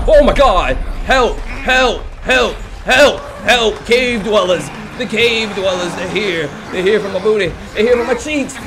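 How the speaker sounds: high, loud cries for help; panicked shouting. Pretend. Gamer warns of attacking cave dwellers, panicked shouting. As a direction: yell out in mock panic.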